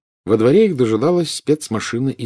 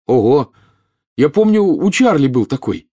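put-on impressed boy, pitched up and louder; admiring; 6 semitones higher, 5 dB louder than usual. A narrator's reading. Boy examines friend's captain badge, admiring. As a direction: marvel at it out loud. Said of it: "восхищённо рассматривая капитанский значок".

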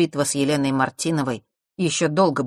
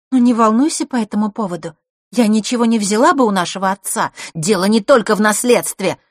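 loud, raised, indignant; heated exchange; 6 semitones higher, 7 dB louder than usual. A narrator's reading. Siblings argue over father's inheritance, heatedly. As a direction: argue it back with force.

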